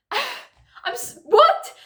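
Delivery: high, loud, disbelieving what; baffled what. Real, not acted. Reacting to her friend's odd text, loud and baffled. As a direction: blurt it out in total confusion.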